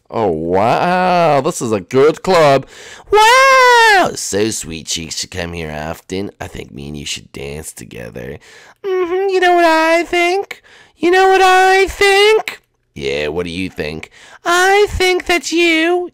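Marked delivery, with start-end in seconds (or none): 3.1-4.1 high falsetto princess voice; sweet put-on lady voice, pitched way up; girl voice. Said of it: "I'll do a girl voice". 8.8-12.6 high falsetto princess voice; sweet put-on lady voice, pitched way up; girl voice. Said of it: "I'll do a girl voice". 14.4-16.1 high falsetto princess voice; sweet put-on lady voice, pitched way up; girl voice. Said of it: "I'll do a girl voice".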